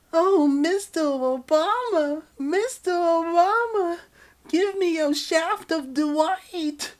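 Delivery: high, loud, slow falsetto moaning Mister Obama; swooning lover. Acted. The lover moaning the name in a high falsetto. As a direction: melt over every syllable of the name.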